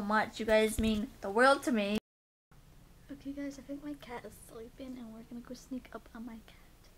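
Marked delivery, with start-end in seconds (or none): 3.1-6.9 hushed whisper so the cat won't wake. Real, not acted. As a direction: whisper softly, sneaking up. Sneaking up on sleeping cat.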